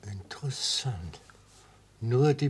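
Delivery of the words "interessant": Flüstern. Acted. Judge whispers with interest.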